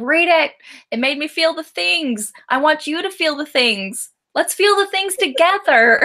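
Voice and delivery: high, eager plea to join in; rally everyone with mounting enthusiasm; gushing book fan. Acted. Voicing eager reader recruiting everyone.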